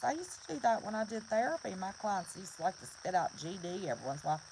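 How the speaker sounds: ordinary talk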